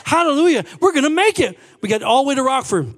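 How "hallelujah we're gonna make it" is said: high, loud, thrilled hallelujahs; excited praise shouting. Retold or imitated. Racing down the freeway, shouting thanks in high excitement. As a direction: cry out in joyful disbelief. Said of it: "And I'm going".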